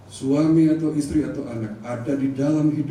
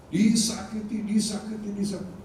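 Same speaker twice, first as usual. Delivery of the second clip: fading lament; 5 semitones higher, 4 dB quieter than usual. Acted. Fading repeated suffering.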